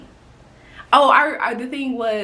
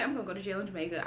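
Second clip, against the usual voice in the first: hushed fast aside; 15 dB quieter, much faster than usual. Retold or imitated. Inner thought retold.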